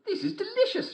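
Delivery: put-on very high, girlish and bright; little girl voice. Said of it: "she said".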